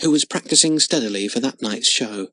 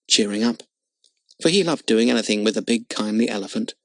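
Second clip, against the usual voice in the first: usual speaking voice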